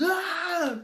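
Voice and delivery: high, crying "ah"; mock tantrum wail; imitated child's wail. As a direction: mimic a worked-up child's cry. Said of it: "he's elevated, he's".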